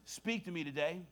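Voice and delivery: soft, phrase-by-phrase prayer with long pauses; quiet prayer fading low to the Amen; repeat-after-me prayer. Real, not acted. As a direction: lead them gently, leaving room to echo. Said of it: "repeat this prayer after me".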